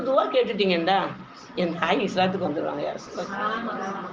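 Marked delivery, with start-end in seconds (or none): 1.6-4.0 much softer, slow and low; soft pleading. Retold or imitated. Abu Huraira softly begs the Prophet to pray for his mother. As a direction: beg gently, as if near tears.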